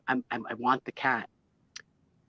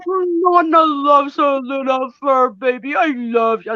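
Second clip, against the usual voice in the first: put-on high, slow, louder sing-song baby talk; 8 semitones higher, 7 dB louder, slower than usual. Grandmother cooing to the kitten in high baby talk. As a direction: coo at the kitten like it's a newborn. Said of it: "in my baby voice".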